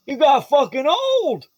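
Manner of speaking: put-on high, slow teasing old father; old dad voice. Old dad teasing his son about aging, high slow voice. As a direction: rib your grown son affectionately. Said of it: "He goes".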